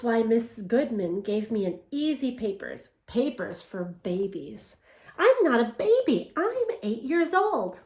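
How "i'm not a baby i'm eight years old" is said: put-on indignant girl. A narrator's reading. Girl protests easy baby papers.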